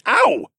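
sudden high yelp of pain. A narrator's reading. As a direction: yelp as if elbowed. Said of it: "I elbowed the Falcon Man".

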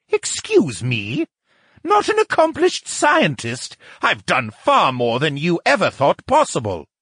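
high, affronted character voice; bristle, pitch leaping in wounded pride; outraged. A narrator's reading. Lucian told he is no accomplished scientist, outraged. Insulted scientist protests his standing.

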